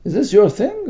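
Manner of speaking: this lifted in a query rise; questioning tune. Shown as a demonstration. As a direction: ask it lightly, just confirming. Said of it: "It was just a question".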